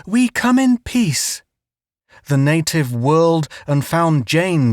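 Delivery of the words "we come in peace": high, slow insistent plea; earnest plea. A narrator's reading. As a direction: plead for peace. Dodging charging native.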